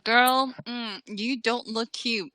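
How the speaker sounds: high, drawn-out scolding auntie voice; a slow, sassy "honey, girl" put-down; sassy Southern old lady. Retold or imitated. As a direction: play a brutally honest older Southern lady. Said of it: "And they're like".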